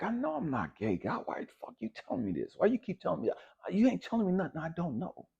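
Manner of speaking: God keeps nagging him and he snaps back, exasperated. Retold or imitated. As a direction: throw it back at him, irritated. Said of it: "I'm like".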